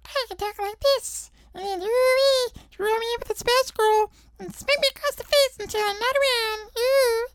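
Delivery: extremely high, thin squeak; squeaky tiny spider. Shown as a demonstration. Small spider asking to be finished off, squeaky voice. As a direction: squeeze the whole voice into a tiny squeak. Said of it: "trying to squeak the voice down".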